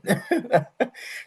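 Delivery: short high laugh at his own joke. Real, not acted. Vlogger laughing at his own jab at women. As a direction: burst out laughing mid-rant.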